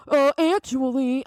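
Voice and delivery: mock know-it-all. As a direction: sneer it like a smug corrector. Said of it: "they're like".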